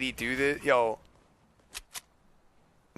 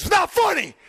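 high, loud mock-furious outburst; angry dad yell; 6 dB louder than usual. Dad turning on his laughing sons, angry yell. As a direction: yell it like a dad who's had enough. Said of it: "he's getting mad that we think it's funny".

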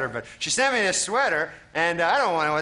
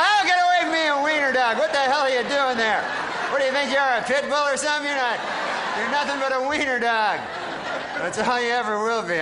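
mock outrage at dog; 8 semitones higher than usual. Waking to a wiener dog, shooing it off in high outrage. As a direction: shriek it away in huffy disgust. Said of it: "And you wake up and go".

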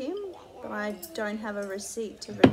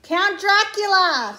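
loud exclamation; 7 semitones higher, 9 dB louder, slower than usual. Real, not acted. Host exclaims the name.

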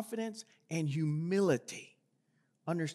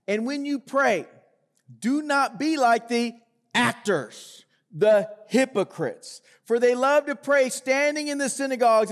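raised, projecting scripture read to the congregation; 5 semitones higher, 7 dB louder than usual. Acted. Pastor reads Jesus' words on prayer to the church, projecting. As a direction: read the Gospel passage out with weight.

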